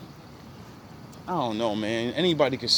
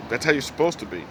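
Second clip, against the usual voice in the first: usual speaking voice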